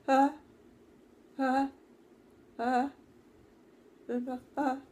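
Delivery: loud, high, stuck ah ah ah; a frightened child who cannot get a word out; stuttered stuck sounds. Retold or imitated. Boy frozen, unable to speak.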